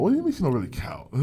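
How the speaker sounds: high-pitched, disbelieving echo; incredulous challenge. Real, not acted. Challenging co-host who says she don't count, incredulous. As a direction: throw the claim back in real disbelief. Challenging co-host's dismissal of the woman.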